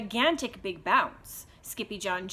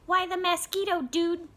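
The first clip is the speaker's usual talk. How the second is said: much higher small-dog voice; tiny chihuahua voice; 8 semitones higher, 3 dB louder than usual. Acted. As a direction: pipe up as the littlest one.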